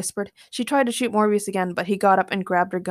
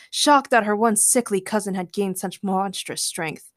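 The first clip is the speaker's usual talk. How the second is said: ordinary delivery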